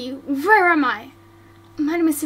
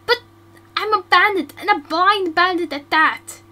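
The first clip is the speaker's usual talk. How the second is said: higher, louder upset objection; distressed protest; 3 semitones higher, 6 dB louder than usual. Acted. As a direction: protest she is unworthy.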